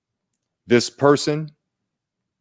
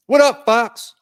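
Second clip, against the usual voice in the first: higher, bright-sounding fish voice greeting back; cheerful fish reply; 13 semitones higher, 5 dB louder, slower than usual. Acted. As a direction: answer like a friendly little fish.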